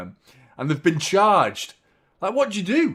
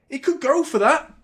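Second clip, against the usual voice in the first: alarmed; 10 semitones higher, 3 dB louder than usual. Real, not acted. Repeat of the line above.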